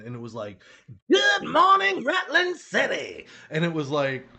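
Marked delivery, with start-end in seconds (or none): 1.1-3.2 high, loud hammed-up greeting; big high-pitched wake-up shout; alley cat character. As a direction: holler it out like a cartoon cat. Acting out his audition line.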